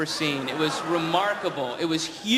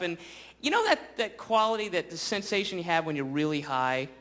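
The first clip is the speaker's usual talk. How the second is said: ordinary talk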